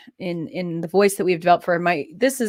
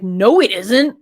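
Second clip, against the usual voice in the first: contradicting inner voice; 8 dB louder, slower than usual. Retold or imitated. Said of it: "my brain was like".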